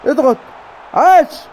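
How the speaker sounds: high, excited calls; urgent cheering. Real, not acted. Gamer urging players to press, then an excited cheer.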